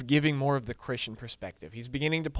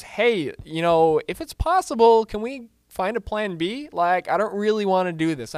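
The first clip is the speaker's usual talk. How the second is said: pleading prayer; 6 semitones higher, 6 dB louder than usual. Retold or imitated. Voices Jesus pleading with God.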